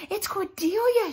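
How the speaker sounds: high excited shout. A narrator's reading. Missus spotting the lost dog, excited shout. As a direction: call it out with joy. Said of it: "shouted Missus".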